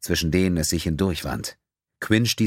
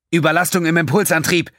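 higher, louder alarmed shout; 11 semitones higher, 6 dB louder than usual. A narrator's reading. Quinn alarms the crew as the engine fails, shouting. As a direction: scream the warning over the failing engine. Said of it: "schrie Quinn".

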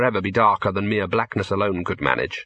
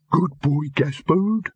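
high, feeble talking-dog bark; barely managed doggy yap in words; faint dog bark; 5 semitones higher, slower than usual. A narrator's reading. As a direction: bark the words weakly, pitched well up. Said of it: "managed the faintest of barks".

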